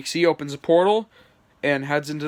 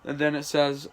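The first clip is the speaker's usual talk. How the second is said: usual speaking voice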